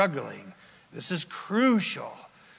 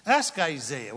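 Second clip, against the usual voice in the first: ordinary talk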